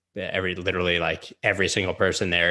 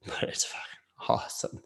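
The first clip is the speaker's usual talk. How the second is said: laughing whisper; 7 dB quieter than usual. Real, not acted. Laughs at pink line.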